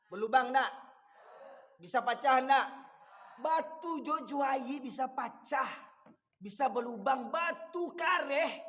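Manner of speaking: loud, high, hammering sermon cries; fiery preaching. Acted. Preacher scolding elders at weddings, loud fiery preaching. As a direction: thunder it at the congregation.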